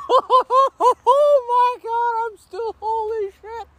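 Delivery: very high, slow, breaking into laughter; laughing in disbelief. Real, not acted. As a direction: laugh through the words, overwhelmed. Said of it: "a nostalgia screamo moment".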